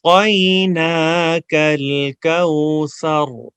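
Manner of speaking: slow melodic chant with pauses; recitation to repeat. Shown as a demonstration. Teacher leads students to repeat after him, slow chant. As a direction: recite for the class to echo back. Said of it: "میں پاز لوں گا".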